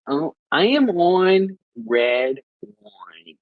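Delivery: drawn-out, badly faked Aussie accent; attempted Australian accent. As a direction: stretch it out in a clumsy Australian twang. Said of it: "difficult to do Australian accents".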